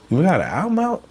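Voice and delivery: high-pitched mock surprise; imitated surprise. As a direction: mimic someone caught totally unaware. Mimics listeners unaware of the album.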